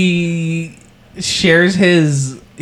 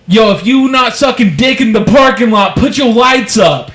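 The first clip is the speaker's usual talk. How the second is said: mock rapper hype; 7 semitones higher, 3 dB louder than usual. Rapper hypes the crowd.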